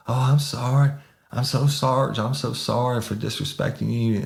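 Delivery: high-pitched imitation of pleading remorse; mock abject apology. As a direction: apologise over and over like a broken man.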